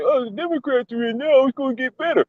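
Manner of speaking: high falsetto mimicking a hopeful voter; mock the naive optimism, squeaky and high. Mocking voters who trust new Democrats in a high falsetto. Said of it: "Ain't nothing ever changing".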